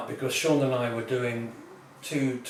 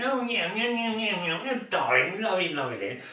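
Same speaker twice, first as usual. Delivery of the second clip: higher, lightly posh cooing couple; posh cocktail couple; 7 semitones higher than usual. Retold or imitated. A hint of posh diners cooing at their table. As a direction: coo like a smug couple over drinks.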